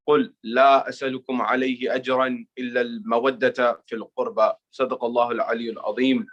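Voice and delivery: brisk, low chanted Arabic recitation; recited Arabic invocation. Real, not acted. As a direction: rattle off the opening prayers in a low chant. Quranic verses before sermon.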